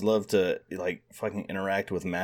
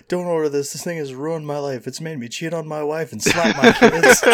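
hammed-up angry complaint, raised; mock outraged review; 6 semitones higher, 6 dB louder than usual. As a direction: rant like a ruined man.

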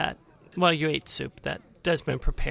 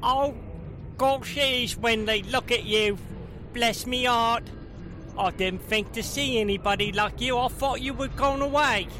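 pixie; 6 semitones higher than usual. Acted. GM voices gnome cousin.

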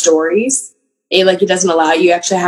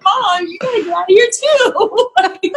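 laughing plea; 14 semitones higher, slower than usual. Retold or imitated. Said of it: "I'm like".

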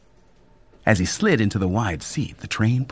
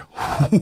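nervous short laugh; 4 semitones higher, 3 dB louder than usual. A narrator's reading. Nervous laugh before question.

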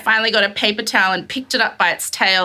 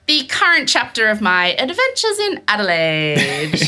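mock announcer. She wraps up her mouse saga, mock announcer. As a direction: announce it like a grand serial title.

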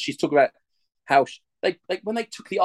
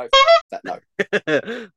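horrified yelp; 9 semitones higher, 5 dB louder, much slower than usual. Real, not acted. Recoiling from scary picture.

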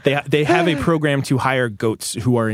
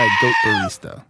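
excited. Acted. Building to punchline.

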